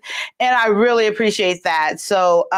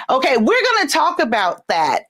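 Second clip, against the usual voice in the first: ordinary talk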